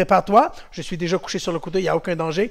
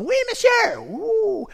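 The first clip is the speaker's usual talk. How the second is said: put-on high chorus of children; kids answering; much slower than usual. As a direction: answer the coach eagerly.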